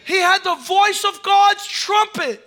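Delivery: loud, slow, high proclamation; slow, loud preacher's call; preaching shout. Acted. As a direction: ring it out like a trumpet. Preacher at full force.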